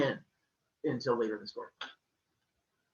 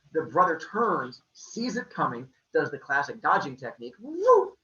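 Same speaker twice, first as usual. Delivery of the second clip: plain reading voice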